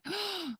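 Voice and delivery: sudden shocked gasp; sharp gasp. Retold or imitated. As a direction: gasp of realization. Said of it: "I'm like".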